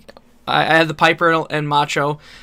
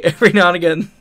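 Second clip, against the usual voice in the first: ordinary talk, nothing marked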